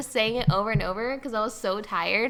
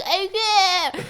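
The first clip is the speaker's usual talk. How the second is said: helpless whine; 11 semitones higher, 5 dB louder than usual. Retold or imitated. Retold helpless reply.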